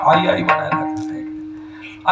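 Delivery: put-on slow, breathless baby-like babble; baby babbling mother. Preacher imitating a baby's first slow words. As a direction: coo it slowly like an infant's first word.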